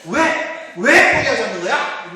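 high, loud demanding yell; villain's shout. Retold or imitated. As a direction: yell it at the stubborn father. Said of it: "이런 대사". (악당의 다그치는 외침)

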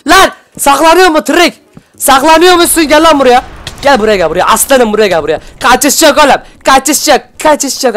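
high, loud taunting chase; hunting the last player; taunting hunter. Real, not acted. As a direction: stalk them and gloat. Hunting hiding enemy.

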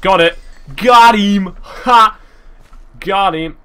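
loud triumphant got him; excited triumph. Real, not acted. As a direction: shout in triumph. Killing the slime.

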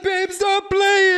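high, slow, loud wailing beg; wailing plea. Retold or imitated. Brittany crying to her boyfriend in the street, loud wailing plea. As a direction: drag it out crying to him. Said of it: "I'm like".

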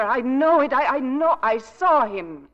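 desperate insistence. Acted. Wife insisting, near hysterical.

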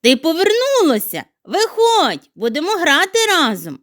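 high, loud happy call; delighted call. Acted. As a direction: glad he came back, call him out. Snowflake glad, calling.

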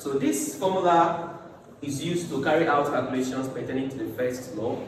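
ordinary talk, nothing marked

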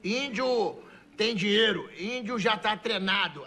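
slow, drawn-out caricature speech; deliberate, word-by-word broken Portuguese; caricature índio. Acted. Índio back from training, slow caricature voice. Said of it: "aí ele falou".

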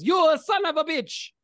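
high, loud hammed-up shout; angry yell. Italian racer flips off a rival, high angry yell. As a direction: yell it as a furious cartoon racer. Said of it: "flips you off and yells".

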